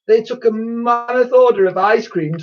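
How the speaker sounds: put-on high, loud childish wail; upset schoolchild's high-pitched lament; sobbed complaint. A narrator's reading. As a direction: wail out the grievance tearfully. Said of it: "sobbed one".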